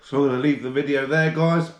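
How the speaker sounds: normal talking voice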